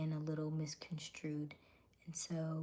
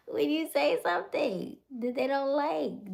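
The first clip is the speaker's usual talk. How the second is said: laughing; 9 semitones higher, 6 dB louder than usual. Real, not acted. Amused at how fast they turn.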